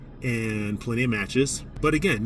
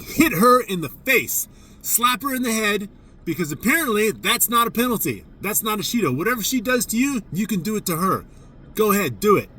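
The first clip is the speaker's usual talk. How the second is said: much higher and louder, sarcastic and provoking; loud sarcastic shout; 9 semitones higher, 4 dB louder than usual. Retold or imitated. Coach shouting to her player so the referees hear, loud and sarcastic. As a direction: shout it across the mat so the refs hear. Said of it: "I said that very loudly".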